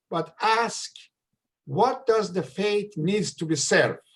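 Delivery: slow, stress on what; emphatic quote. Retold or imitated. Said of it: "Pay, please pay attention".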